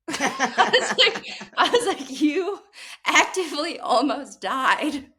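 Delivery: high, laughing mock outrage; laughing disbelief. Real, not acted. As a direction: tease her mom while laughing. Mock-scolding mom, laughing.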